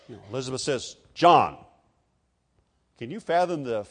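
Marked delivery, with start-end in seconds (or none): 1.1-1.6 "John" punched out loud; passionate insistence. Retold or imitated. Elizabeth insists to relatives, "John" loud and emphatic. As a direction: declare "John" forcefully, as final. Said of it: "with great passion".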